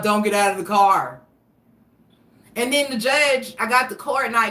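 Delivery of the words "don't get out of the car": retold order through a police car speaker; mock cop over the car's PA; cop on loudspeaker. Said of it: "hit his little speaker thing".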